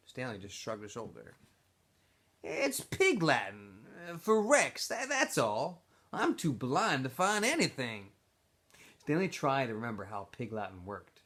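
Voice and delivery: expressive read-aloud of a chapter; story narration. A narrator's reading. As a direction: tell the story to a classroom. Teacher reads novel aloud.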